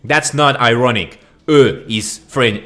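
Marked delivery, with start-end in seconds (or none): 1.5-1.8 "Euh" as a loud, drawn vowel sound; French eau. Shown as a demonstration. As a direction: say "Euh" like a stroke-muddled French word. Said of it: "is French for water".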